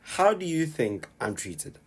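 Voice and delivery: ordinary delivery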